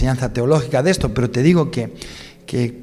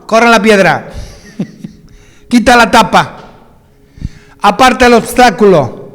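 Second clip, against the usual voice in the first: loud, higher, firm commands; commanding shout; 8 semitones higher, 7 dB louder, slower than usual. Acted. Jesus at the tomb commanding them, loud and firm. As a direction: order the stone away with authority. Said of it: "gritando con una voz".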